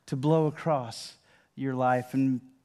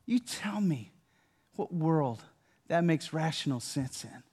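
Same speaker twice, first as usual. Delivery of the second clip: usual speaking voice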